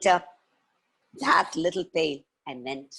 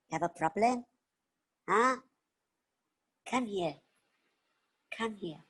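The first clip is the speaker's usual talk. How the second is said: put-on sympathetic little girl; 6 semitones higher than usual. Little girl consoling a sad boar, sympathetic.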